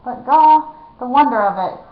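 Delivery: ordinary delivery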